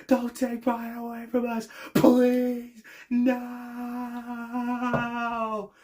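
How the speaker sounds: mimicking heartbroken fans, high and pleading; fans begging. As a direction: beg them not to take him, overacted. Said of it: "They're just like".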